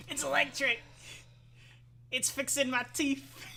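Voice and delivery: high, loud, far-off squeal; shrill excited exclaim. Pretend. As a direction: shriek it like a zapped kid. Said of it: "and you go".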